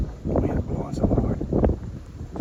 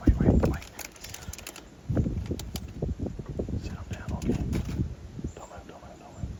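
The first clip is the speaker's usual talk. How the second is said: urgent hushed whisper; wildlife watching whisper; 7 dB quieter than usual. Real, not acted. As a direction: whisper tense orders, barely voiced. Deer looking right at them.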